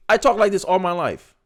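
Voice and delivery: ordinary talk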